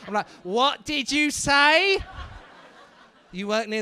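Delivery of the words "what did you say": loud, drawn-out question, pitched up; exaggerated asking. Comedian who just can't hear the audience member, slow loud question. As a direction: stretch it out as if to someone out of earshot. Acts struggling to hear someone.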